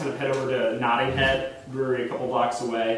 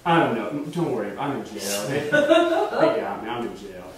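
wasted man; 5 semitones lower than usual. Retold or imitated. Drunk friend brushing off a worried caller, low and wasted. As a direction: ramble like a drunk, sagging at the end. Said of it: "He's clearly, like, wasted".

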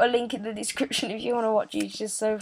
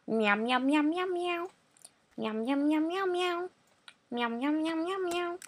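meowing cat; 7 semitones higher than usual. Retold or imitated. Imitating a cat in game.

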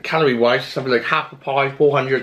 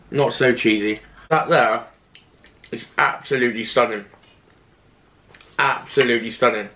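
words mumbled around food; talking while chewing. Real, not acted. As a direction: mouth partly full of pie. Seen 2: bite at -1.0; lips pressed, chewing while cutting pie.

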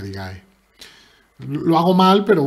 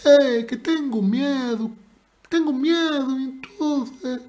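high, slow, sing-song Galician accent; 10 semitones higher, slower than usual. Shown as a demonstration. Streamer teasing his opponent to admit fear, Galician accent. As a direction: put on a drawn-out, high-pitched Galician accent. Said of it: "Con acento gallego".

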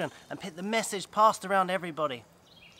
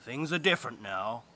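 stern earth voice; 5 semitones lower than usual. Acted. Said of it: "the earth said".

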